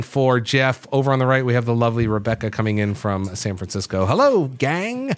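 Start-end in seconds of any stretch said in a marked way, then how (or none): 4.1-5.1 sing-song greeting. Acted. Host greets guests, drawn out.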